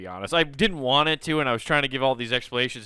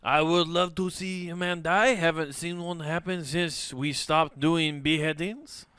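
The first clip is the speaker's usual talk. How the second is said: put-on French accent; 3 semitones higher than usual. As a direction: muse bloodthirstily in a French accent. Acting French onlookers wishing death.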